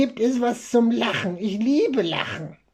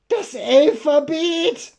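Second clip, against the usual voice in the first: high, slowish puppet voice; silly punchline; 7 semitones higher, slower than usual. Acted. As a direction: deliver it like a goofy puppet. Joke punchline.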